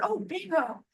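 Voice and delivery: high, delighted Bingo call; excited Bingo call. Retold or imitated. She mimics neighbours calling Bingo, high and delighted. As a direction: call it out like a happy win.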